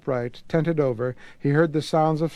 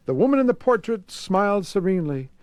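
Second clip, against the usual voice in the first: usual speaking voice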